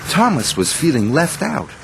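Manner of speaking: plain reading voice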